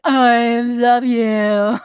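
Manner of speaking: slow, drawn-out mock adoration; mock gushing. Telling the doctor she loves him, slow mock adoration. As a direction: stretch it out like a smitten fan. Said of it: "I was basically like".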